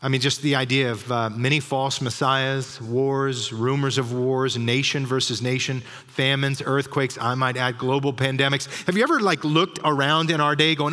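usual speaking voice

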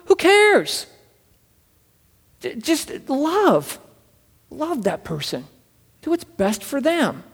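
full-force preacher building to a plea; impassioned preaching. Acted. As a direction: pour your heart out to the congregation. Preaching climax on love.

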